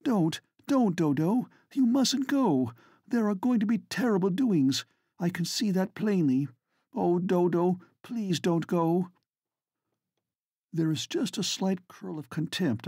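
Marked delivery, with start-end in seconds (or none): 0.0-9.1 cling to her and beg her to stay; pleading. A narrator's reading. Vivi clinging to her friend's neck, high desperate pleading. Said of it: "thrown her arms round Lady Manderton's neck".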